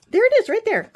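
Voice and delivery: high thrilled point-out; excited. Real, not acted. Pointing out her dress on the page, thrilled. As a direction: squeal it as you spot it.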